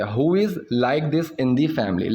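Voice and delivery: slow, deliberate example sentence; slow teaching demo. Shown as a demonstration. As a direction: lay each word out slowly. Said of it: "जो पूरे परिवार में ऐसी है". (धीरे-धीरे समझाते हुए)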